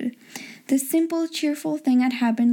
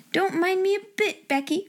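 put-on higher-pitched girl's exclamation; raised pitch without raised volume; mildly crying out; 7 semitones higher than usual. A narrator's reading. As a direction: call it out lightly, pitched up. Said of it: "cried Ermengarde".

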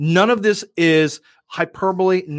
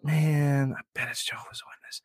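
muttered dread; 10 dB quieter than usual. Retold or imitated. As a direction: grumble under your breath, hushed and quick. Grumbling to himself at doorbell.

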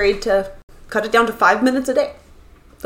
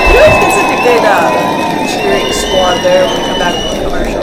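mock cheering; 3 semitones higher, 8 dB louder than usual. Mock cheering after ad break.

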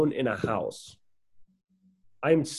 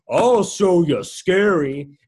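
slow, louder, drawn-out mock reply; imitating the homeless man; 4 semitones higher, 8 dB louder, much slower than usual. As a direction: stretch it out like the odd stranger. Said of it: "He's like".

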